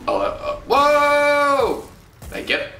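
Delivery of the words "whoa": long, loud, high exclamation; startled whoa. Real, not acted. Startled by explosion in game.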